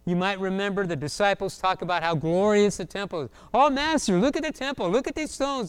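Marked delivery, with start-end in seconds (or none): 3.5-5.7 high, excited sightseer chatter; gawking tourists. Acted. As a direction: gush breathlessly over every new sight. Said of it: "They sounded like a bunch of tourists".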